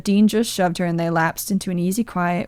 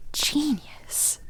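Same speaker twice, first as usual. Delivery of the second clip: breathy whisper, high and soft; breathed out; 8 semitones higher, 9 dB quieter than usual. Acted. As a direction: breathe the word out in awe. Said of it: "Charlie breathed out".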